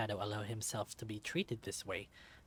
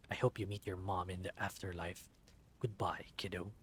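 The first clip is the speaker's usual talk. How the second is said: cold whisper; 5 semitones lower, 5 dB quieter than usual. Acted. Killer whispering farewell.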